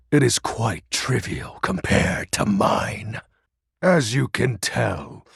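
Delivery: ordinary delivery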